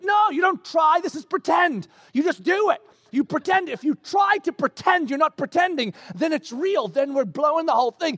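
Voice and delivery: high-pitched, emphatic protest; acted exasperated insistence. Husband correcting wife about pretending, exasperated insistence. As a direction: argue it as comic frustration.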